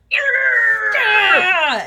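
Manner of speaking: loud, high cheer; big cheer; excited shout. Acted. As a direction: whoop as the wrestling starts. Cheering into a wrestle.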